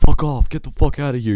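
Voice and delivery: high, strained yell; retold scream. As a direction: scream him off. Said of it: "I'm, like, screaming at this dude".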